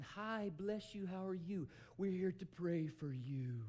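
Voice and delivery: high, slow, syrupy church greeting. Retold or imitated. Mocking overly nice Christians greeting someone hurting, slow and sugary. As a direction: ham up fake niceness.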